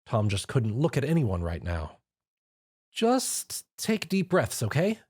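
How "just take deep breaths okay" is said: slow, higher, soothing; calm him gently; reassuring. Acted. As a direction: friend soothing a shaking man. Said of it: "Twilight reassured Tom".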